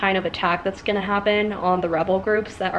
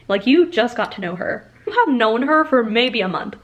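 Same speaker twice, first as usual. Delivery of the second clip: ordinary talk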